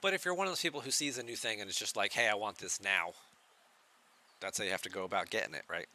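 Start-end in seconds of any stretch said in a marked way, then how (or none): none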